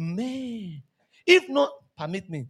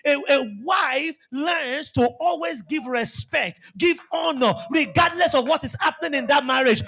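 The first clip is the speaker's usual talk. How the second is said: loud, rising preacher's cry; full-force pulpit preaching; fiery preaching; 4 semitones higher, 3 dB louder than usual. Acted. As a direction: drive the sermon home with force.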